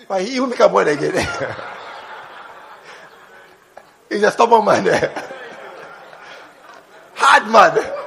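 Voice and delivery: chuckling through the words; laughing. Real, not acted. Preacher laughing about the stubborn man. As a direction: laugh between short loud phrases.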